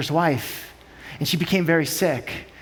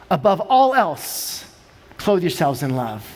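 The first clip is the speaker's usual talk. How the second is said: slow, dropping low on clothe yourselves in love; hushed scripture repeat; solemn scripture reading; 3 semitones lower, slower than usual. Real, not acted. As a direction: repeat the verse reverently.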